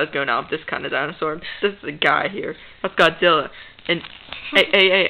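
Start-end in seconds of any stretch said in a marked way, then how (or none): none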